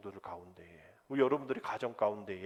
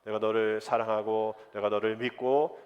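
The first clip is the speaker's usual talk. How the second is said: proclaimed much louder to the whole room; loud greeting; 10 dB louder than usual. Shown as a demonstration. Pastor leading the congregation in a loud greeting. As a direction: call it out to your neighbour, full voice. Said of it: "우리 인사합시다".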